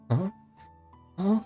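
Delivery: usual speaking voice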